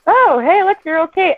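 high, sing-song casual comfort; breezy reassurance. Acted. Parent to fallen kid who looks up, breezy high-pitched reassurance. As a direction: play down the fall for a toddler. Parent playing down kid's fall.

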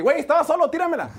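high, whiny I-was-open plea; complaining teammate. Retold or imitated. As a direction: whine that you were wide open. Flag football guy wanting ball.

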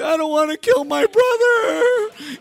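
put-on high, slow, crying little kid; mock sobbing child. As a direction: wail it like a sobbing five-year-old. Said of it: "I was crying".

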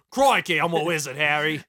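loud, hammed-up Australian; mock Australian accent. Host doing an Australian wizard line, hammed-up accent. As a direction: boom it out in a silly Australian accent. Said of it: "when Australian".

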